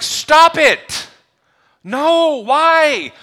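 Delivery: high, loud cry of protest; mock distraught yell. Viewers at home pleading with the screen, high mock yell.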